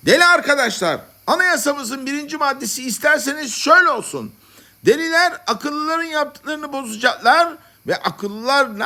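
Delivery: sustained, very high-pitched declaiming; assembly orator. Acted. Madmen drafting their constitution, declaiming to the assembly. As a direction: proclaim each point to a big crowd. Head madman runs the assembly.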